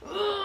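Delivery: high breathy effort sound; straining ooh. Retold or imitated. Mother relives the final push with a high straining ooh. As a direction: strain through the last push.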